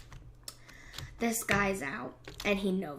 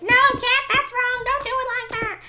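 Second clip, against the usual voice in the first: high, loud mock scolding; imagined viewers scolding; 13 semitones higher, 8 dB louder than usual. Viewers mock-scolding Kat for a wrong build, high and loud. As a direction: play the bossy viewers correcting her. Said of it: "You're probably like".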